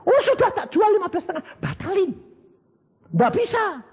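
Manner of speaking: high, laughing quick refusal; rich lady refusing. Acted. Rich lady turns down the other buyer, high and laughing. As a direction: brush him off with a laugh.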